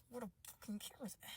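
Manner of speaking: very soft, quick, barely there; hushed, hurried disbelief; muttered repeat. Acted. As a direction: mutter it under the breath. Repeats it under her breath.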